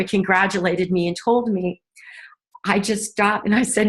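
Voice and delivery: ordinary delivery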